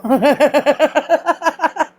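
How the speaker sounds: long, rising burst of laughter; helpless run of high giggles; real laughter. Real, not acted. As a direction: crack up at the joke. Laughing at the Karen joke.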